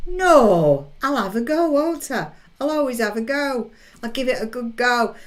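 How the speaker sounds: defiant. Real, not acted. Defiant about singing badly.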